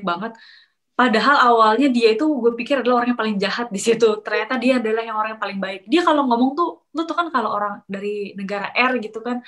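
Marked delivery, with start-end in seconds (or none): none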